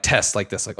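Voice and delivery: usual speaking voice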